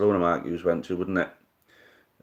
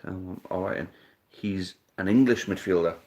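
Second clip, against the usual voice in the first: ordinary talk, nothing marked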